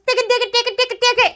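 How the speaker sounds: high, rapid-fire mock Indian chatter; mock Indian accent. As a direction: rattle it off in a caricatured Indian accent, high-pitched. Mimicking Indian speech mid-rant.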